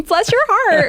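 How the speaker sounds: imitating her own surprised high squeak; voice going up high. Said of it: "nor does my voice usually go up that high".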